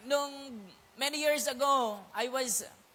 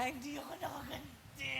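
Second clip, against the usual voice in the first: sulky confession; 2 semitones higher, 11 dB quieter than usual. Retold or imitated. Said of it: "Sabi ko ganyan".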